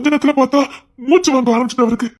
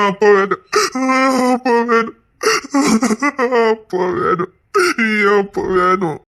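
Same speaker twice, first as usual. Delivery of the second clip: crying with sobs between phrases; tearful, repeated wail; sobbing for father; slower than usual. Acted. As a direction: weep for your dad. Weeping child wants father.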